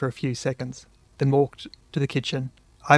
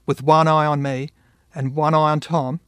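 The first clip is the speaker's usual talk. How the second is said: ordinary delivery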